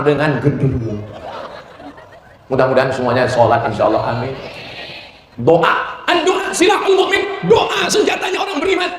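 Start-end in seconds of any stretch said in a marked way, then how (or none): 6.3-8.9 high shouted proclamation; proclaiming. Real, not acted. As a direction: shout it out to the crowd. Preacher proclaims doa.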